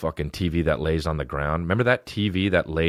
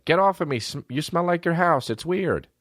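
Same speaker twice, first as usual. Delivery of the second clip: put-on squeaky little kid shoving you off; little cousin voice; 11 semitones higher than usual. Comedian mimics his young cousin, high and bratty. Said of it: "and he said".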